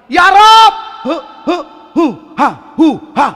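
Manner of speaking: tiruan doa bahasa Afrika, nada tinggi; doa orang Afrika. Retold or imitated. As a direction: meniru jamaah Afrika berdoa, dibuat-buat. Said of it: "Dia baca doa dengan bahasa dia". (tiruan logat Afrika yang dilebih-lebihkan)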